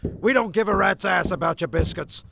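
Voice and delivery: ordinary delivery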